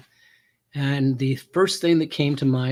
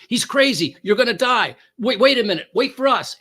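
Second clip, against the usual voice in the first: higher, louder, urgent warnings; frantic radio chatter; 7 semitones higher, 4 dB louder than usual. Retold or imitated. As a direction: imitate cops yelling over each other. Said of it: "are all jumping on the radio saying".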